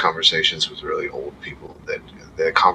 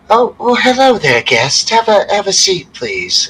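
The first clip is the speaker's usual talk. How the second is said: much higher, sugary hostess voice; innkeeper's sweet greeting; 7 dB louder than usual. Acted. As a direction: greet the guests with forced sweetness. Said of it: "She goes".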